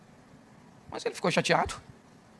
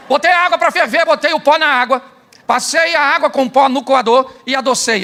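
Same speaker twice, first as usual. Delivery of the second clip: mock proud; 5 semitones higher, 6 dB louder than usual. Shown as a demonstration. Demonstrating the 'wrong' coffee method.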